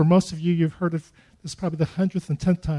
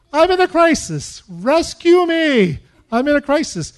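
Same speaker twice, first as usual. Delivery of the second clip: desperate pleading; 7 semitones higher, 4 dB louder than usual. Retold or imitated. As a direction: cry out for rescue as if drowning. Begging God for rescue.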